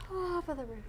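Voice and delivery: imitated sleepy voice. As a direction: mimic someone nearly dozing off. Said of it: "Like you're about to fall asleep".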